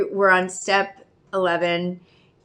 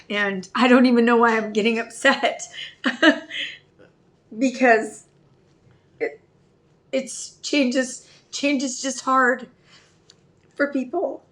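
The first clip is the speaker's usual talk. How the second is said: tearful, laughing through tears, higher; getting teared up; 3 semitones higher than usual. Real, not acted. Telling her story, slightly tearful. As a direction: hold back tears with a shaky laugh. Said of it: "getting teared up".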